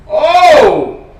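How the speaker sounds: loud high gasped Oh; sudden outburst of shock; loud shocked exclamation; surprised shout. Real, not acted. Reacts to news.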